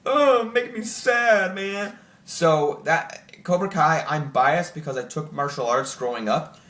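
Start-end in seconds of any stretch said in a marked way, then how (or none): 0.0-2.0 mock sad. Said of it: "and it's like".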